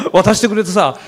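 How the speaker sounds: ordinary delivery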